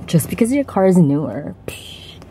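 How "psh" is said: soft, voiceless scoff; dismissive psh. Real, not acted. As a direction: blow it off with a hushed psh. Dismissing his snobbery.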